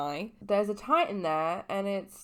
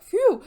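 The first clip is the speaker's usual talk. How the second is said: high, loud sigh of relief; a big exaggerated phew; relieved phew; 11 dB louder than usual. Percy relieved that Annabeth stays, loud phew. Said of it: "Percy's like".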